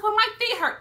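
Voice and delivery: high-pitched mock protest. Lady answering her seatmate, high imitated protest. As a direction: plead your case in a high voice. Lady defends herself again.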